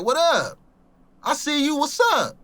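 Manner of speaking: high-pitched mock call to someone; retold call-out. Talking to himself in a hazy world, retold high call-out. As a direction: call it out high, each phrase falling off. Repeats his calling out.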